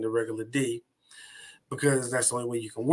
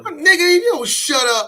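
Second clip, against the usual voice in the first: much higher, louder, calling out to a buddy; loud playful banter; 13 semitones higher, 8 dB louder than usual. Retold or imitated. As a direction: yell it like ribbing a friend. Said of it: "we got a way of talking to each other".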